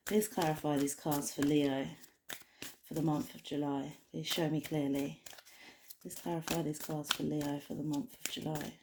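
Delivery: soft, low-key plea to the cards; murmured invocation. Real, not acted. Reader asking the cards to clarify for Leo, murmured. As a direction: ask it quietly, almost to yourself.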